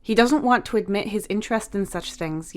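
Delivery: ordinary delivery, nothing marked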